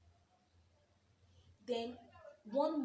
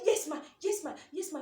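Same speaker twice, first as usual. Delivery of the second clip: eager yes; 5 semitones higher, 10 dB louder than usual. Retold or imitated. Eager pleading to boss.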